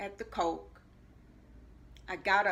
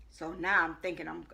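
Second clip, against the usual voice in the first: normal talking voice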